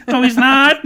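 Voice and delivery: mock wrestler. Mocking the ad's wrestler.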